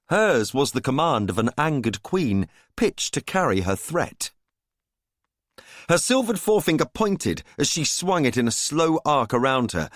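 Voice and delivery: ordinary delivery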